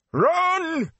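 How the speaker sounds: high, loud anguished scream; screamed cry. A narrator's reading. Tom fighting the Fade, screaming to the boys. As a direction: scream the word in pain. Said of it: "Tom began to scream".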